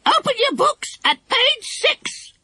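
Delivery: very high, slow parrot voice; stern parrot teacher. Acted. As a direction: parrot mimicking a schoolmaster. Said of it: "said Kiki sternly".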